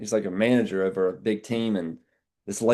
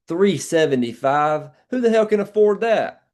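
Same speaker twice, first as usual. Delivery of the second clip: mock outrage; 8 semitones higher, 5 dB louder than usual. Salesman hamming up anger for the client's benefit, mock outraged. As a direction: put on a show of being furious on their behalf.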